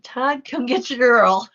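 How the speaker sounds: mock call-out. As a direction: playfully summon Todd to come fetch her. Joking call-out to Todd.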